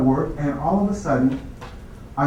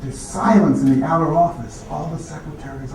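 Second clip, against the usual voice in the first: ordinary delivery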